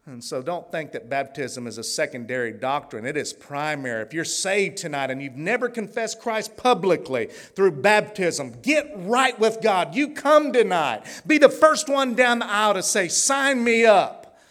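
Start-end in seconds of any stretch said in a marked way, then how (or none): 4.0-14.4 rising, loud preacher's appeal; fervent invitation, building to a cry; altar call. Acted. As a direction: call them down the aisle.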